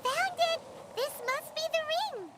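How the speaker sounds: high, bright burst of discovery; thrilled little cry of triumph; excited find. Acted. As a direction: shout it out as you spot the treasure. Finding the lost ring.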